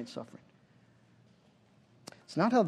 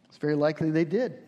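ordinary talk

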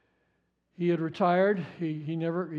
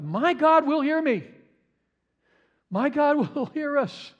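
bold declaration; 8 semitones higher, 4 dB louder than usual. Acted. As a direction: proclaim it high and loud, sure of being heard. Preacher voicing Micah's bold faith.

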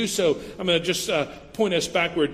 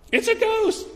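much higher, scared cry of a disciple; frightened disciple. Acted. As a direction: squeal it like a terrified fisherman.

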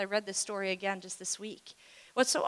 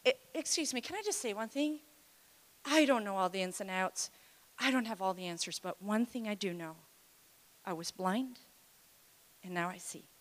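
humble quiet testimony; 6 dB quieter than usual. Retold or imitated. Healed man's quiet testimony.

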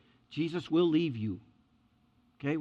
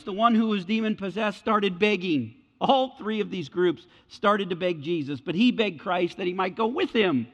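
louder, rising preaching build; 4 semitones higher, 5 dB louder than usual. Acted. Preacher driving home the begging point, loud and building. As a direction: preach it with mounting fire.

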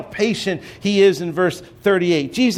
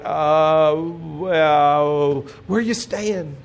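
hesitant disciples; much slower than usual. Retold or imitated. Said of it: "they just kinda like".

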